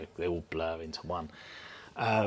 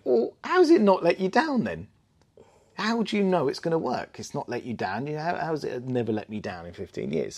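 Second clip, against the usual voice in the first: much higher, louder lady's retort; 7 semitones higher, 6 dB louder than usual. Retold or imitated. Lady at the table questioning the caravan owner, high mock voice. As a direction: pitch it up like an incredulous lady. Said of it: "So another lady went".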